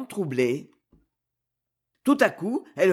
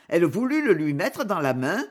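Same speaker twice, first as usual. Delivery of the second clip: ordinary delivery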